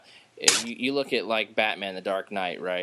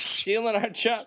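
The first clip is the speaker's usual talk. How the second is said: hammed-up echo of the joke, raised pitch; mock yokel cry; 10 semitones higher than usual. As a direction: play the angry cartoon local. Echoing the joke.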